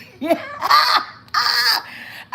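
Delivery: a loud, high burst of laughter. Real, not acted. Laughing at her own slip.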